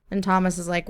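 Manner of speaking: normal talking voice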